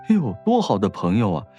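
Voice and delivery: normal talking voice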